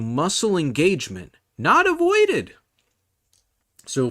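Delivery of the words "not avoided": emphatic. Acted. Emphatic correction of lifting advice.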